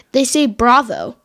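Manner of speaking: normal talking voice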